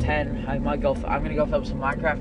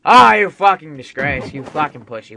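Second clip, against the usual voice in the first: furious shout at the screen; angry cursing; 13 dB louder than usual. Real, not acted. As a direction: berate the game enemy. Cursing at game boss.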